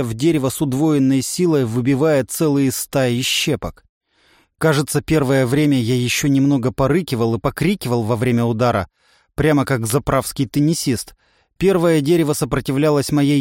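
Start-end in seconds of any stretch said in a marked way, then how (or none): none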